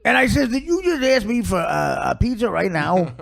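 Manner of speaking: hammed-up incredulous dad; put-on exasperated father complaining about his son; father imitation. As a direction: caricature of a dad who can't believe the request. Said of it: "The father talks like this".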